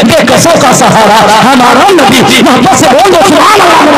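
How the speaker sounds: loud, high, slowed fervent chant; preaching at full force. Acted. Preacher chanting praise of the Prophet, loud and slow. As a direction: stretch each phrase out for the crowd.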